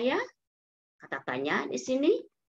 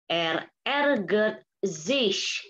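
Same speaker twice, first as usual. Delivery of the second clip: slow model sentence; much slower than usual. Shown as a demonstration. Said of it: "teacher models German slowly".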